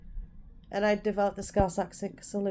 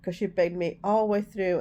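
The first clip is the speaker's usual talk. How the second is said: ordinary delivery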